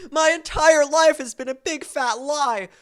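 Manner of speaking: hammed-up shock at a lie; mock betrayal. John learning Jürgen isn't German, mock dismay.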